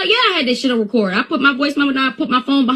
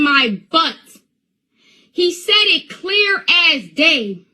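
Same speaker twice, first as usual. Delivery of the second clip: high, drawn-out emphatic insistence; indignant disbelief; 7 semitones higher, slower than usual. Real, not acted. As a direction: press each point in a raised pitch, incredulous. Indignant defence of son's account.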